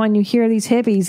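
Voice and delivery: ordinary delivery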